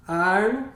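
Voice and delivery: "Arno" said loud and separate; one word per block. Shown as a demonstration. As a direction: say "Arno" on its own, loudly, then stop. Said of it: "E aí você pode falar". ("Arno" dito alto e destacado)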